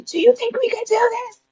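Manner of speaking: tiny high-pitched scared voice; imitating a frightened, very high plea; retold fear. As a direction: plead for reassurance in a high squeak. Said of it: "I remember getting scared".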